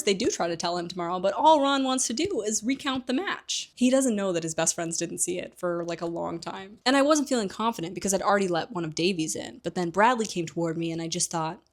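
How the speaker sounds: ordinary talk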